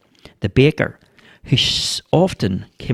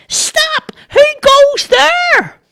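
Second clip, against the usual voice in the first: very high, drawn-out squeaky yell; boy shouting louder; 5 dB louder, much slower than usual. A narrator's reading. Boy shouting so the deaf neighbour hears, squeaky yell. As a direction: yell it slowly in a piercing child's voice.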